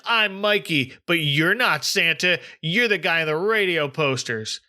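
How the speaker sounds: ordinary talk, nothing marked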